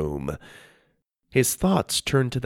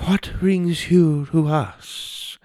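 strange thin man; 7 semitones higher, much slower than usual. Acted. Strange man's voice.

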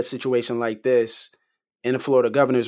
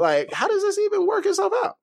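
very high, baffled question; disbelief. Real, not acted. Cohost stunned by the hanging joke, high disbelieving. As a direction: throw up your hands in disbelief.

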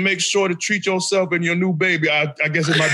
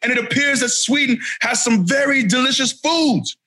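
hype gas-up; 4 semitones higher than usual. Acted.

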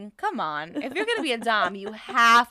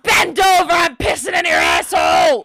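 louder and higher shouted threat; joking shout; 4 semitones higher, 6 dB louder than usual. Pretend. Co-host shouting a joke punishment, loud. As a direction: bark it like a mock punishment.

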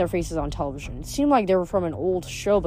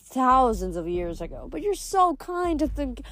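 high, effusive lady's voice; higher-pitched gracious goddess; gushing river goddess, flattered; 7 semitones higher than usual. A narrator's reading. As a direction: charm your guests, flattered.

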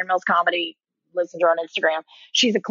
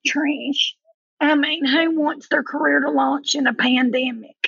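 put-on higher-pitched thick Southern accent; Leanne; 5 semitones higher than usual. Friend phones to complain about career timing, imitated Southern accent. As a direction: mimic a friend's Southern accent, vowels stretched. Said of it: "her accent makes mine sound like it's non-existent".

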